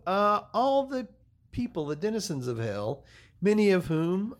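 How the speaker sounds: slow, emphatic show-opening announcer read; mock-grand podcast welcome; podcast host intro.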